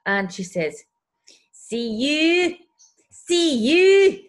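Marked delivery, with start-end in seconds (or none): 1.5-4.2 imitating a Scottish neighbour, much higher and louder. Scottish neighbour confronting her at the door, imitated Scottish accent. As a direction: put on the Scottish lilt, calling out. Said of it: "she's Scottish, by the way".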